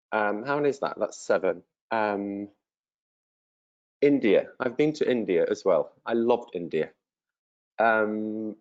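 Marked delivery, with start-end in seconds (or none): none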